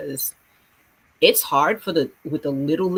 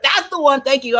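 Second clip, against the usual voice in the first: much higher, louder delighted cry; teacher thrilled to find the song; excited; 9 semitones higher, 7 dB louder than usual. Retold or imitated. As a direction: burst out with glee. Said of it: "I'm typing it up".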